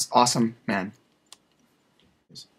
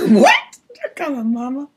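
high put-on voice; 10 semitones higher, 5 dB louder than usual.

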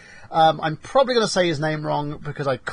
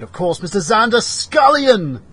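higher, louder, slow emcee call; announcer intro; 6 semitones higher, 5 dB louder than usual. Acted. Host introduces the guest with a big emcee call. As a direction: bellow the guest's name like a ring announcer.

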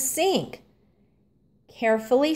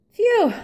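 hot phew; 12 semitones higher, 4 dB louder than usual. A narrator's reading. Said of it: "said Arthur after a while".

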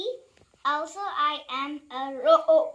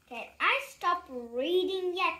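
ordinary delivery

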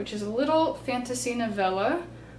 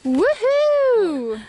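high, loud victory cheer; loud whoop; 12 semitones higher, 9 dB louder than usual. Real, not acted. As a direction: yell it out to the valley. Celebrating reaching the top.

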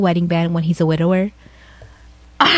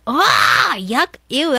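higher, grossed-out yuck and ew; higher, disgusted ew; 4 semitones higher, much slower than usual. Real, not acted. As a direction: recoil in mock disgust. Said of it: "reacting to the post".

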